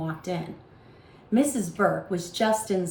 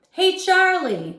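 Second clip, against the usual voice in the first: high, loud, slow hail; Tommy loudly hailing his friend; calling out; 10 semitones higher, 7 dB louder, slower than usual. A narrator's reading. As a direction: sing out his name across the playground. Tommy calls out to Charlie.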